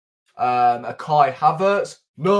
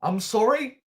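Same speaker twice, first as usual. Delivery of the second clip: incredulous; 7 semitones higher than usual. Real, not acted. Incredulous at Fulham's record.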